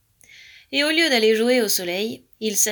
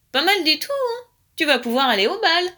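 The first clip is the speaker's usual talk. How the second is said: high, louder approval; pleased praise; 7 semitones higher, 5 dB louder than usual. A narrator's reading. Pigeon congratulating his dance pupil, pleased praise.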